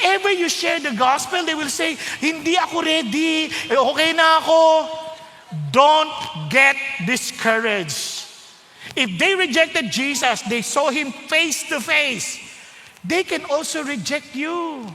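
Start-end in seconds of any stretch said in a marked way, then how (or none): 2.2-4.9 loud, slow brush-off; dismissive refusal. Acted. Acted refusal of gospel. 5.7-14.9 slow, deliberate encouragement; slow emphatic exhortation. Acted.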